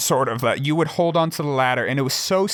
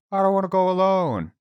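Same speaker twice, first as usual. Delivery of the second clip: higher, lightly put-on kid voice; retold childish plea; 5 semitones higher than usual. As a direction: imitate a kid pleading for company. Imitating Nate's plea to tentmates.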